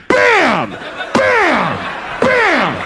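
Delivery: high, punchy repeated yells; impact shouts. Retold or imitated. Man imitates partner backing into him, high punchy yells. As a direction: bang out each one hard. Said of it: "She's going like".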